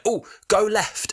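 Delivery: high, slow mock Doctor. Podcaster imitating the Doctor in the taxi, high and slow. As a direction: stretch it out in a lightly hammed Doctor impression. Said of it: "he's like".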